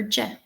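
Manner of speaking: J said as a short "juh"; isolated J sound. Shown as a demonstration. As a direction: say J as its sound, not its letter name. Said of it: "This is the symbol for J".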